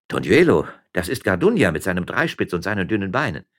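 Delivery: loud cry of recognition; exclaiming. Acted. Miller spots a shadow on the road, exclaiming. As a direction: exclaim it, strongest on the name. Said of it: "dass der Müller sofort ausrief".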